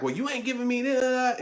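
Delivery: high-pitched imitation of a griping partner; mock complaint. Voicing a self-centred partner's complaint.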